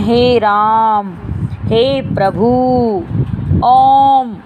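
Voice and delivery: very slow calling with each vowel held long; prolonged vowels. Shown as a demonstration. Teacher showing how we call someone, long drawn-out vowels. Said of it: "तीन मात्रा का समय लगता है".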